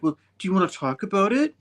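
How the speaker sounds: high, quick, rising offer to listen; mock counseling. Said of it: "trying to counsel him".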